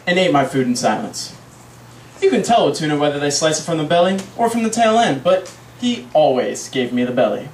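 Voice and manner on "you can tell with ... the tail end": normal talking voice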